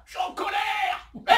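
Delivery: loud, very high mock-angry bleat; angry bleating sheep. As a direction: protest shrilly like a cross ewe. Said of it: "Il a la voix d'une brebis".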